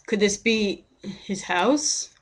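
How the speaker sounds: slow, drawn-out question with a rise on "this"; dramatic pause. Pretend. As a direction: stretch "could this be his house?" with a pause before "house". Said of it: "I thought that was a dramatic pause".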